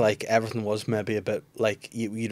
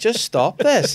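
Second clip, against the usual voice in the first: mocking child; 11 semitones higher, 7 dB louder, much slower than usual. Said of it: "she goes".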